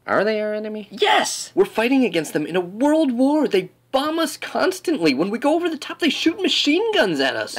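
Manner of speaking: very high, exasperated outcry; outraged insistence. Acted. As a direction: shriek it out in disbelief. Outraged soldier insists they're enemies.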